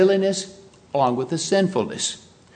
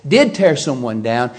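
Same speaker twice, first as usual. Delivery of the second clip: ordinary talk, nothing marked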